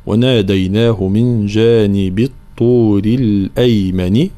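slow tajweed demo ending "الأيمن" on an i; recitation model. Shown as a demonstration. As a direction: recite "الأيمن" slowly with a clear final kasra. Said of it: "نعطي الآن بعض الملاحظات الموجودة في تلاوة هذه". (ترتيل تعليمي بكسر نون الأيمن)

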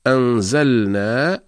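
أنزلنا slowly recited, clear sakin lam; recited example. Shown as a demonstration. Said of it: "كما في قول الله تعالى".

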